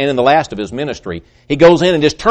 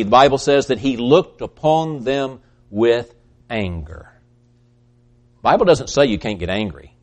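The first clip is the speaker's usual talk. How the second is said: ordinary talk, nothing marked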